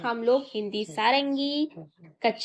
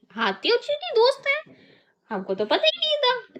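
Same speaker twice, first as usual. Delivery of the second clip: high, fast mock surprise; mock amazement; 11 semitones higher than usual. Teacher pretending amazement to her pupils, high and quick. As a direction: act astonished for the kids.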